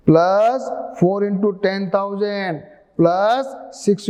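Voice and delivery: very slow, each term spaced out for writing; slow dictation. Shown as a demonstration. Teacher reading the expansion aloud slowly. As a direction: dictate it slowly so students can write. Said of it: "देखो यहाँ लिखा है".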